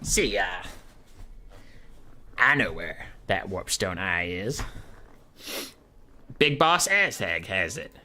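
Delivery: slow goblin drawl. Acted. Goblin telling Skrulk who has the eye, slow drawl. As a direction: draw it out as a goblin informant. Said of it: "the goblin politely declines. And he goes".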